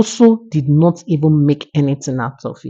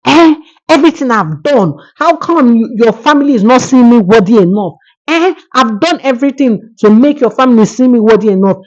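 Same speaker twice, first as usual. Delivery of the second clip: frustrated, raised voice, fast outburst; angry husband; 6 semitones higher than usual. A narrator's reading. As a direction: complain bitterly, voice up high. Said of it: "He was so angry".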